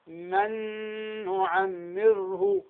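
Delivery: "من نعمره" drawn out slowly, long nasal hum on ن; Ghunna-Demo. Shown as a demonstration. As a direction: hold the merged ن of "من نعمره" for two counts in the nose. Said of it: "فتقول ماذا؟".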